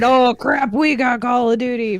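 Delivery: mock-excited cheer of surprise; excited fans. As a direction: imitate thrilled fans getting big news. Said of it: "everybody was excited".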